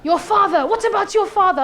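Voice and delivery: loud, high mock outburst; going ballistic. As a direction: imitate an officer exploding with frustration. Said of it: "But ballistic".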